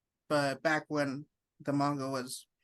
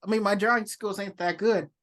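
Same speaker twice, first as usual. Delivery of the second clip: excited; 5 semitones higher, 6 dB louder than usual. Real, not acted. Friend excited about fan contest.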